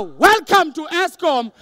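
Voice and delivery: loud, drawn-out, irritated greeting; slow, exasperated front-desk shout; annoyed receptionist. Retold or imitated. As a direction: say the welcome as if it means go away. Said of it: "The tone is".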